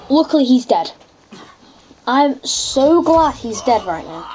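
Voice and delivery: normal talking voice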